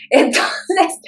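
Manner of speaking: a real laugh bursting into "Entonces". Real, not acted. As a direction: laugh it out, then carry on. Laughs after her point.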